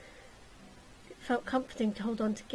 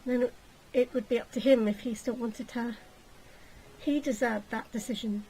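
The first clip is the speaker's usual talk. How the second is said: plain reading voice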